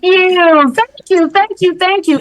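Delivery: high, fussy thank-yous; mock prissy thanks. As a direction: gush thanks in a put-on high voice.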